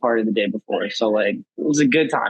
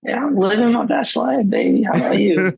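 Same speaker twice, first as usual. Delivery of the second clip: raspy voice; 8 semitones higher than usual. Real, not acted. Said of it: "that's just your new raspy voice you're trying out".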